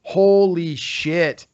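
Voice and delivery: slow, stunned holy shit; drawn-out amazement at the party; slow awestruck exclamation; awed. Retold or imitated. Overwhelmed at star-filled party.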